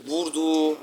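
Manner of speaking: high, loud goal call; excited commentator. Acted. Gamer commentating his own goal like a hyped announcer. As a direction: call the goal like a play-by-play caster.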